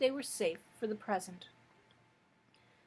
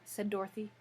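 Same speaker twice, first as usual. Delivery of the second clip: ordinary delivery, nothing marked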